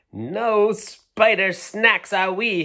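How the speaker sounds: loud, high, slow bug leader's shout. Acted. Doodlebug leader shouting at the Spider Queen, loud and high. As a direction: call it out like a brave little leader. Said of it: "shouted the Doodlebug leader".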